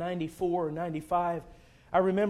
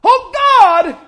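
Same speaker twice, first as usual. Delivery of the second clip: much higher, much louder anguished plea; retold desperate shout to God; crying out to God; 22 dB louder, much slower than usual. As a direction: cry out to God from the bottom of despair. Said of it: "I remember crying out and said".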